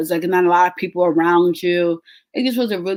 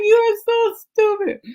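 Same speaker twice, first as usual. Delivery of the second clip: much higher, slow, laughing through it; laughing scold. Retold or imitated. Laughing at her husband's joke, high giggly scold. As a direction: scold him while cracking up. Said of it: "I was like".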